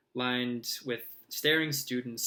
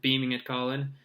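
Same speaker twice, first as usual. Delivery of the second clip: ordinary delivery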